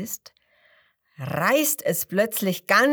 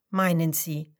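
ordinary delivery

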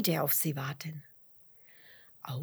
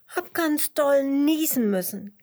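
high, louder little-snail voice; kleinlautes Geständnis; 8 semitones higher, 7 dB louder than usual. A narrator's reading. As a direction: admit it as a small snail. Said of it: "gibt die kleine Schnecke zu". (hohe Stimme der kleinen Schnecke)

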